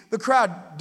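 ordinary talk

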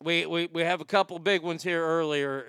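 usual speaking voice

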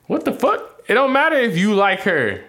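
high-pitched, heated objection; indignant retort. Real, not acted. Father telling his child's mother her opinion doesn't matter, indignant.

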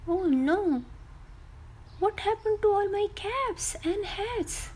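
high, dismayed cry of the cap seller; dismayed vendor. Acted. Cap seller finding his bag empty, high dismay. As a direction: sound like your stock just vanished. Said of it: "suddenly realized that his bag is empty".

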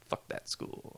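unvoiced aside whisper. Real, not acted. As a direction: breathe it under your breath.

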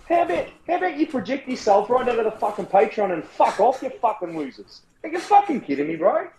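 high-pitched furious swearing rant; swearing tirade. Real, not acted. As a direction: unload on them, pitch way up. Ranting at the Patreon members.

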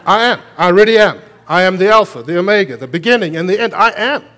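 loud, high, ringing proclamation; preaching at full force. Acted. Preacher crying out God's words to the congregation, full force. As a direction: proclaim it to the whole church, full voice.

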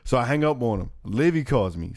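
usual speaking voice

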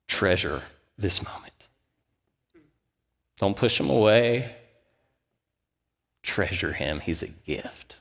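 slow, soft, hushed thought; soft inner voice. Retold or imitated. Father hearing a thought about his son, hushed and slow. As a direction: let it land like a quiet revelation, slowly.

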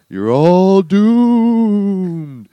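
very slow, loud doom prophet; slow ominous raspy drawl. Shown as a demonstration. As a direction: drag it out like Crazy Ralph. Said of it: "do the Ralph?".

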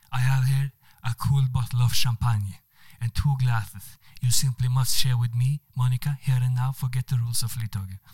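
charming Spanish man with a put-on accent. Spanish diplomat charming the woman on the train, Spanish accent. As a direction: play the suave Spanish diplomat. Manuel offers champagne.

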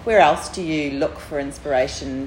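normal talking voice

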